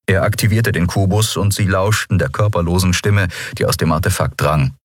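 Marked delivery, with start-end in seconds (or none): none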